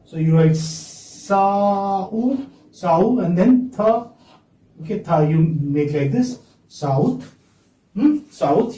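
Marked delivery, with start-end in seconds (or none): 0.0-3.2 Sa-u drawn out syllable by syllable; Sa-u, stretched sound by sound; slow spelled-out sounds. Shown as a demonstration. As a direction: sound out Sa-u very slowly. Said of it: "South you write like this".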